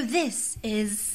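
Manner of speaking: formal platform announcement; tube announcer voice. Shown as a demonstration. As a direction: try out the tube lady. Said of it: "Do they go".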